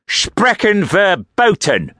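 high, slow, hammed-up German accent; a guard mocking in a comic German accent. Guard mocks the prisoner in a comic German accent. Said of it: "in a comic German accent".

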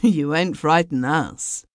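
ordinary delivery, nothing marked

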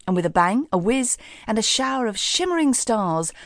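ordinary delivery

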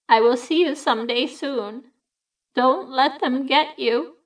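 a dead sister at peace saying goodbye; old lady's voice. A narrator's reading. Evelyn's ghost reassuring her sister, gentle and serene. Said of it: "an old lady's voice, Evelyn's voice".

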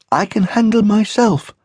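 high small rabbit voice, lightly muttered. Acted. Shiloh grumbles back at his mother, lightly muttered. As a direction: grumble it to yourself. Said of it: "Shiloh muttered".

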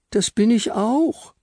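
very high, slow pleading little voice; squeaky Frau Stern. Acted. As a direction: plead sweetly in a tiny high voice.

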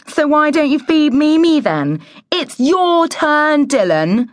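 put-on high, bossy childish sneer; sneering girl. A narrator's reading. Girl sneering at her brother about his turn, high and bossy. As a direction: sneer it high, stretching the words. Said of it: "the girl sneered".

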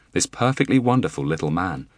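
plain reading voice

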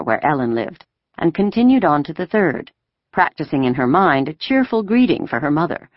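plain reading voice

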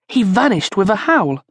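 ordinary delivery

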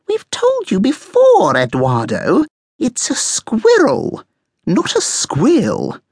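high, drawn-out scolding; bossy know-it-all, stretching the words. A narrator's reading. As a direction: correct him slowly in a high, bossy voice. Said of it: "Coco could be quite bossy sometimes".

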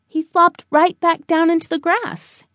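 ordinary delivery, nothing marked

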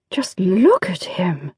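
slow, awed whisper; hushed gasp of admiration; breathless whisper. Acted. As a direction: whisper it in wonder. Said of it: "Miss Beckwood gasped".

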